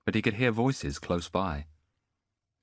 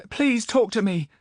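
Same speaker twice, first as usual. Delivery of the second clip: put-on much higher, louder young voice; pleading boy; 6 dB louder than usual. Boy begs Zidantus, high pleading voice. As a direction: beg to be heard.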